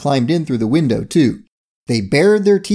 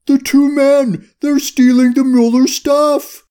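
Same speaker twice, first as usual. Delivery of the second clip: high, loud drawn-out howl; howled alarm; 13 semitones higher, 5 dB louder, slower than usual. A narrator's reading. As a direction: howl the warning slowly at a very high pitch. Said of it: "Bubba howled".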